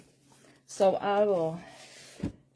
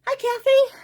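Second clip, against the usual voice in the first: excited greeting; 5 dB louder than usual. Real, not acted. Greeting a viewer on live stream.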